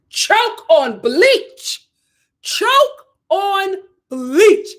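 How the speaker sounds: slow, rising "Choke on bleach"; slow scornful jab; drawn-out insult. Real, not acted. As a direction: stretch the words out in contempt. Scornful insult at a public figure.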